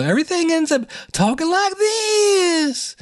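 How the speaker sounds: mock her drawn-out sing-song way of talking; imitating her speech pattern. Podcaster mimicking Ruby's speech pattern, high held-out tune. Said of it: "she has her own speech pattern".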